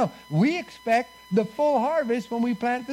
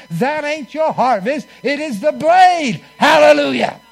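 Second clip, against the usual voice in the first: louder and louder, driving preacher cadence; preaching at full force; 4 semitones higher, 7 dB louder than usual. Acted. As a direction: build it up to a shouted hallelujah. Preacher builds to a climax.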